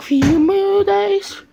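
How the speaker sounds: slow, high, loud evil threat; menacing villain voice. Pretend. Kid as evil Selena threatening the dog. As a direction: ham up a cartoon villain gloating.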